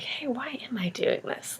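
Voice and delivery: breathy whisper to herself, wondering; whispered self-question. Retold or imitated. Herself in the moment, whispering a question to herself. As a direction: hush it as a sudden private realization.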